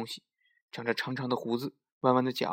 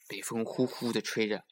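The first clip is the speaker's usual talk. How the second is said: usual speaking voice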